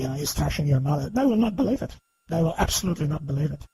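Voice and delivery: usual speaking voice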